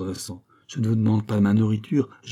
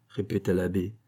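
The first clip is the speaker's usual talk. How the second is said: ordinary delivery, nothing marked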